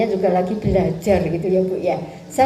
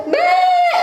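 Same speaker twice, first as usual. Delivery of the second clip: high sung bleat through laughter; playful goat mbek; laughing goat bleat. Retold or imitated.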